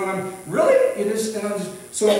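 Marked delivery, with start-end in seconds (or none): 0.5-0.8 high, rising retold disbelief; astonished question. Shopper flustered by the checker's good news, astonished. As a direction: pitch it up sharply as an acted double take.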